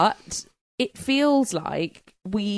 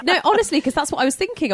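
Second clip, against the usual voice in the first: laughing; 10 semitones higher, 3 dB louder, faster than usual. Real, not acted. Laughter after joke.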